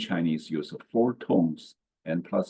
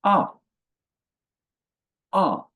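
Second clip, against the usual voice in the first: "Ah" short, sharply falling; "Ah" with a brisk falling tone; fourth tone; 11 semitones higher, 5 dB louder than usual. Shown as a demonstration. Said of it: "sharp and falling".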